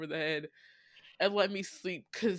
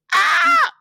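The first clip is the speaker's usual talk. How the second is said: delighted squeal; 18 dB louder than usual. Real, not acted. Squealing at a cute flirty line.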